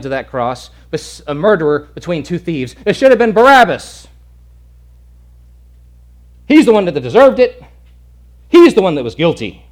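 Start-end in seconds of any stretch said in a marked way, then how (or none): 2.8-9.7 louder, higher emphatic preaching; preaching emphasis. Real, not acted. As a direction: drive the point home. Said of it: "sermon emphatic peak".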